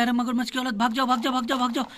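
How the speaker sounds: ordinary talk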